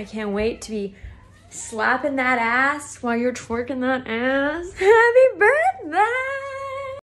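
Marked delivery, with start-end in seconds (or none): 4.8-7.0 slow, high squealed happy birthday; high gleeful squeal; excited shriek. Real, not acted. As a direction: squeal it out with glee.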